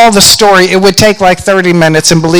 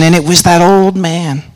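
ordinary talk

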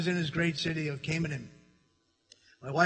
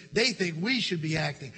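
ordinary delivery

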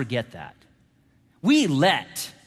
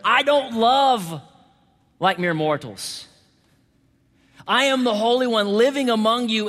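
high, emphatic scripture declamation; forceful preaching; 6 semitones higher, 4 dB louder than usual. Acted. God vows not to destroy Israel, declaimed forcefully. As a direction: proclaim it as God's own firm vow.

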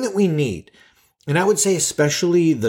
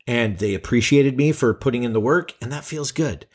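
ordinary talk, nothing marked

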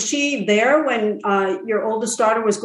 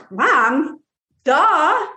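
high, teasing mom duh; mock duh; 5 semitones higher, 3 dB louder than usual. Son telling mom the obvious, mocking. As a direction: tease it as totally obvious. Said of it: "He goes".

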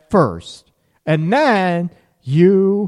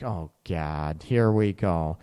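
exasperated groan; 11 semitones lower, 5 dB quieter than usual. Retold or imitated. Partner groaning at the request.

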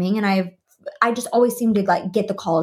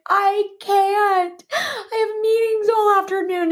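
high-pitched tearful protest; imitating a weepy, squeaky wail; mock bawling; 12 semitones higher, slower than usual. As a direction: whine it as if crying from tiredness. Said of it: "I just started bawling".

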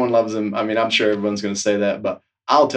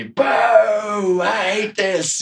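drawn-out jeering boo, higher and slow; mock booing; 8 semitones higher, much slower than usual. Snowboard instructors hearing about the new boss, mock boo. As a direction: boo it out like an unhappy crowd.

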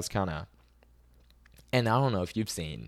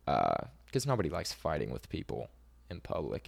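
normal talking voice

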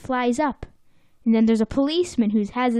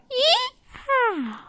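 very high Southern cowboy yee-haw; bad Southern accent; 14 semitones higher than usual. Cowboys running at full speed, bad Southern whoop. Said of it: "That's the worst Southern accent I've ever done".

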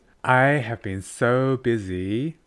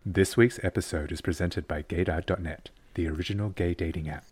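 podcasting voice; 5 semitones lower, 4 dB quieter than usual. Acted. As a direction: lower sponsor read. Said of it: "Excellent podcasting voice".

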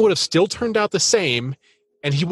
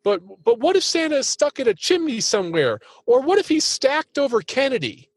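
put-on high, childlike worried Big Bird voice; imitating Big Bird; 9 semitones higher than usual. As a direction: fret like Big Bird up on the roof. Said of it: "he says".

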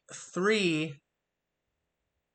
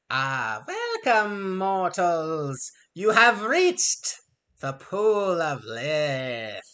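harpy voice; much slower than usual. Acted.

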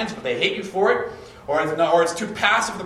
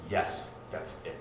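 low, soft, slow relieved yes; quiet agreement; 12 semitones lower, 10 dB quieter, slower than usual. Retold or imitated. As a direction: let it settle, softly and slowly. Fiancée finally agrees.

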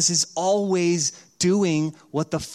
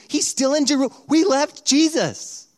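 acted parental panic, slowing on the last words; panicked realization; 10 semitones higher, 4 dB louder than usual. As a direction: realize it in horror, pitch shooting up. Parents realize child left behind.

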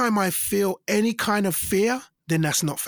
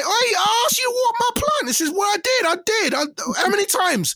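high, loud, exasperated friend voice; mock indignant complaint; 4 dB louder than usual. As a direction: scold the friend for the dead plant.

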